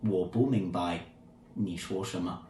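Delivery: low, soft, sultry Mandarin; sultry Chinese tone. Shown as a demonstration. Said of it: "Said in his very sultry Chinese tone".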